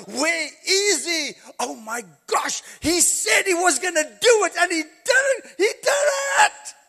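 high, loud, laughing revival preaching; fired-up Easter sermon; preaching at full force. Acted. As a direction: bring the congregation to its feet.

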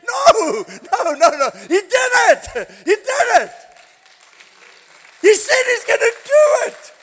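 very high, very loud triumphant shout; overjoyed roar from the pulpit; ecstatic preaching shout. Acted. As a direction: shout the victory. Preaching at full force.

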